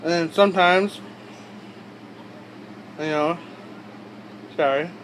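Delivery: talking around a wad of dip in the lip; lip full of dip. Real, not acted. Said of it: "kinda hard to keep dip in your lip. Talking and moving your lip".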